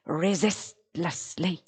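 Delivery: slow, soft beats on "resistlessly"; like a drum. Shown as a demonstration. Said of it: "It's a fabulous drum".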